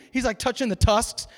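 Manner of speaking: normal talking voice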